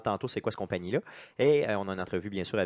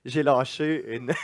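cracking up while confessing; laughing; 4 dB louder, slower than usual. Real, not acted. Admits fart, cracking up.

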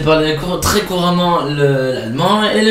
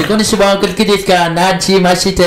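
Japanese greetings rattled off, raised pitch; faster; 4 semitones higher than usual. Shown as a demonstration. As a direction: run the phrases together quickly. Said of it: "si tu veux que je le fasse plus vite".

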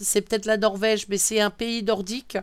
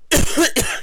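loud rasping coughs; coughing fit; 6 semitones higher, 5 dB louder than usual. Real, not acted. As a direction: cough again, still not settled. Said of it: "si ma toux veut bien se calmer".

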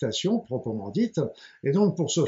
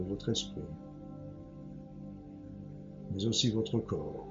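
guided meditation; 5 semitones lower, 9 dB quieter than usual. Acted. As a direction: ease them gently into calm.

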